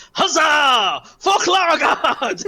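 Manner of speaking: high, loud, drawn-out toast; triumphant Klargard. Acted. Klargard toasts the sky with raw eggs, triumphant shout. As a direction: roar a heroic war cry. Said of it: "lifts it up to the sky and goes".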